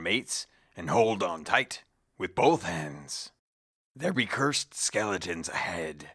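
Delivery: lightly voiced salty old pirate; gruff old fisherman. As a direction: growl it like an old sea dog. Quoted in-game pirate speech.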